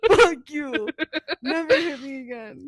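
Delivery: loud scolding with a laugh in it; mock angry. Punched partner warns boyfriend, mock angry.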